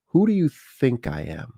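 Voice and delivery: acted-out confrontation, not shouted; indignant retort. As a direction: demand an answer without raising your volume. Acted retort to yelling stranger.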